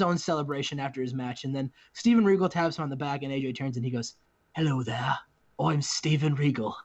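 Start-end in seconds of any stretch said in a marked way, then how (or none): 4.6-6.8 hammed-up bad British accent; badly faked British greeting, lower than usual; terrible British accent. Steven Regal introducing himself to A J, terrible British accent. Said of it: "That's my terrible British accent".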